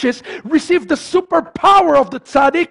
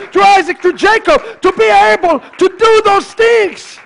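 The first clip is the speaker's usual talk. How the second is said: high, pounding pulpit cadence; full-force preaching; 8 semitones higher, 5 dB louder than usual. Real, not acted. Preacher rallying congregation with the patriarchs, full-force preaching. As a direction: hammer out each repeated phrase.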